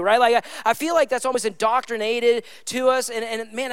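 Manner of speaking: normal talking voice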